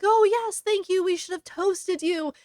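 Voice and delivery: high, loud, lightly gushing thanks; a touch of gushing gratitude. Retold or imitated. Gushing character quote in retelling.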